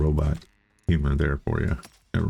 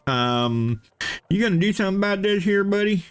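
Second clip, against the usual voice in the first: very high put-on character voice; mock worker voice; 14 semitones higher than usual. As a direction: pipe up in a squeaky, comic worker's voice. Voices the complaining workers' quote.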